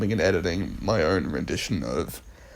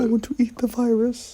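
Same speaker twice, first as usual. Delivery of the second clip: much higher cartoonish voice; high silly voice. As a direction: squeak it like a goofy cartoon. Silly put-on voice joke.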